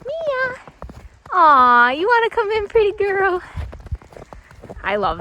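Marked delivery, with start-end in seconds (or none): 0.0-3.4 high cooing pet voice; talking to a horse. Real, not acted.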